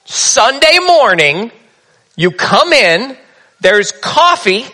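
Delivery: drawn-out, slow listing of Sunday steps; slow mock routine. Shown as a demonstration. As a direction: lay out the ritual very slowly.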